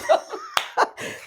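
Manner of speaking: high squealing burst of laughter; embarrassed giggle at the memory. Real, not acted. Laughing at own crying story.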